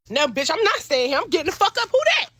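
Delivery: rising to a high, loud shout; loud demand. Retold or imitated. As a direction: call out at the stranger upstairs. Girlfriend arrives angry.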